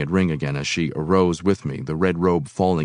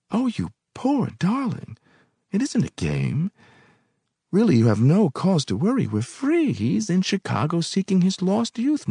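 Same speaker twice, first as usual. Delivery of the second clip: cooing woman; 12 semitones higher than usual. A narrator's reading. Woman soothing her nervous guest, high cooing voice. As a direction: purr it like a coy temptress.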